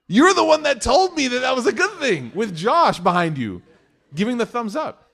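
father protesting to son about the shoes; indignant mock shout. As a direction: throw it back at him in exasperation. Dad's indignant retort.

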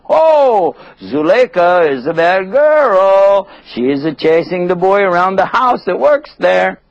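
high, loud sing-song teasing; drawn-out, high-pitched taunt; mocking gossip. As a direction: jeer like gossiping townswomen. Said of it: "they were making fun".

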